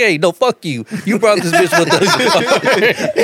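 high-pitched quarrel replayed with laughter breaking in; retold angry comeback. Driver tells friend off over his passenger, high retold anger.

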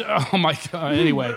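normal talking voice

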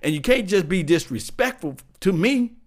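a touch of an indignant player; demanding player. Retold or imitated. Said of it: "What you say is".

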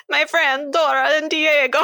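mock the actor's bad Mexican accent; bad fake Mexican accent. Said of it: "tries to do a Mexican accent".

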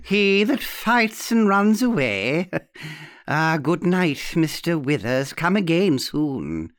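high, slow taunt softening to farewell; arch old aunt. Acted. Aunt bidding the fleeing guest goodnight, archly amused. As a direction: tease with a laugh, then say goodnight. Aunt's voice in the story.

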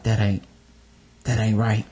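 low muttered disapproval; low disapproving mutter. Retold or imitated. His own retold disapproval.

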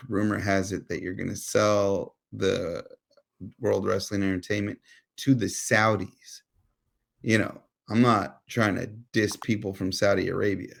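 usual speaking voice

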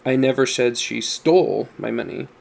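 "stole" stretched and emphasized; stress on STOLE. Shown as a demonstration. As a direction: lean on "stole", then drop away on the rest. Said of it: "depending on the emphasis you give".

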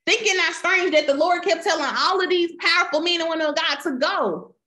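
rising fervent preacher's exhortation; preaching. Acted. Preacher exhorting the congregation to go, fervent. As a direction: urge the church onward with growing fire.

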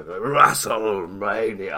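slow, drawn-out announcer call. Shown as a demonstration. Host redoing the show intro for stream viewers, drawn-out hype call. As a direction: stretch it out like a big ring announcement. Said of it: "I didn't say that with enough gusto".

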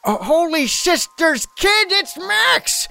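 very high, cracking cry of disbelief; overwhelmed shout. Acted. Dak spots his long-lost Max.

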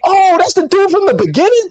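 very high, exaggerated surprise; hammed-up shocked realization; mock astonishment. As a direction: squeal it like a fake big revelation. Mocks a plot-twist reveal.

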